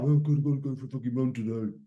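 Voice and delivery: moaning about the run, sinking quiet at the end; grudging, fading complaint; a runner dreading his run, grumbling; reluctant grumble. Pretend. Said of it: "You can wake up and you can think".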